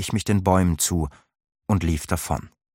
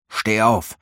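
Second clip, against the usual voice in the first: gruff hoarse command; 3 semitones higher than usual. A narrator's reading. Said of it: "stieß Thor barsch und heiser hervor".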